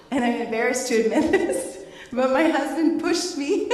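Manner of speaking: laughing through embarrassment, high and loud; embarrassed laughing story. Real, not acted. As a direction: giggle out the embarrassing part.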